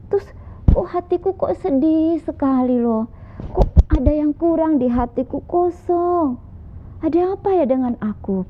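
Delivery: put-on high, puzzled voice of a lonely young man; a young man asking into empty space, high and wondering. Young man alone in space wonders aloud, high and puzzled.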